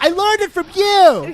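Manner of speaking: high, loud talking-cat comeback; mock accusing cat. Cat answering its owner back, loud high-pitched mock accusation. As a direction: throw it back as an aggrieved cat. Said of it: "The cat's like".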